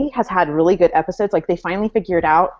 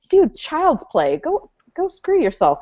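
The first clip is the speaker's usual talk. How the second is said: higher, drawn-out dismissive retort; mock scornful comeback; 8 semitones higher, slower than usual. Voicing what Claire should tell the British soldier, mock scornful. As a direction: brush him off, slow and high.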